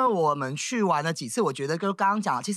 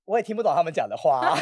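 ordinary talk, nothing marked

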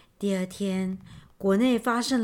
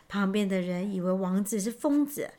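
plain reading voice

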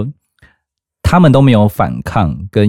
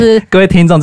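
loud host address; announcer; 12 semitones higher, 5 dB louder, faster than usual. Pretend. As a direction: announce it to the listeners. (主持人播报腔)

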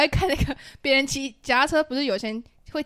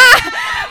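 high embarrassed shriek of laughter; 13 dB louder than usual. Real, not acted. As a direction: squeal with laughter. Embarrassed at being teased.

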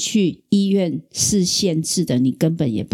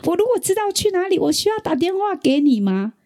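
imitating dad snapping, much higher and fast; mock exasperated yell, pitched way up; frantic angry outburst; 12 semitones higher than usual. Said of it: "我爸爸突然大爆炸，把我臭骂了一顿". (模仿爸爸急躁地大骂，声音高而快)

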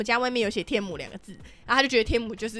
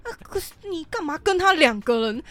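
slow, drawn-out high voice of a shocked grandma; mocking the grandma; 5 semitones higher, slower than usual. Grandma hearing of a two-person trip: slow high scandalised mock voice. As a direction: drag each word out as a scandalised granny. 然后他瞬间变脸了.

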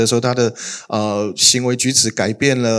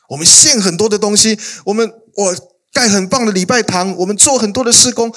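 much higher, eager bragging list; boastful Israel; 9 semitones higher than usual. Acted. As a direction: brag about all your offerings. (高声夸耀地列举)

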